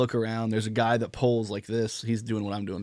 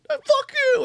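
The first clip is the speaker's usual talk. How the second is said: loud, very high imitated shriek; hammed-up high-pitched outburst; mock scream; 7 dB louder than usual. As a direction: squeal it out like the furious girl. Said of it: "Starts screaming".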